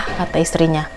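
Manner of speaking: normal talking voice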